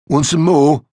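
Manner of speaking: high-pitched cheeky taunt. Acted. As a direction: goad them as the class pest. Annoying boy taunts with berries.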